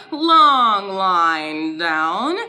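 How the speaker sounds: very slow, stretched "Long line down"; slow drawn-out instruction. Shown as a demonstration. Teacher guiding fingers down a long line, slow and stretched. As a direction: draw the words out like the stroke. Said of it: "Write it again, fingers up".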